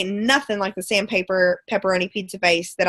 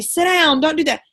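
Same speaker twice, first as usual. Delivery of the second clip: higher mock classroom yell, sharp and short; teacher yelling; 5 semitones higher than usual. Teacher snapping at kids at lunch, mock yell. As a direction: bark it at the kids like a frazzled teacher. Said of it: "screaming at somebody".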